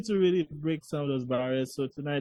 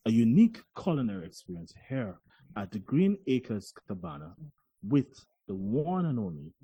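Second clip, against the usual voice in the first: announcer voice; 4 dB louder than usual. Pretend. Host introducing the chef of the evening, full announcer voice. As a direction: announce it grandly, stretching out the final name. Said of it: "let's get back that announcer voice".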